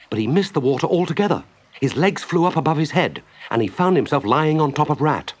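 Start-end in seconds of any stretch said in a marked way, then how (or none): none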